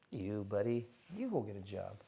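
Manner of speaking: low, quiet comeback under the breath; muttered retort. Retold or imitated. Boxer silently answering people who tell him to retire, low and quiet. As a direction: mutter it back to yourself.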